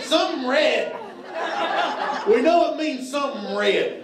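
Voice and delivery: a hint of a Southern waitress explaining; light Southern accent imitation. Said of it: "even with a southern accent".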